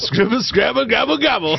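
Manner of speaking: high mock gobbling chant; turkey gobble.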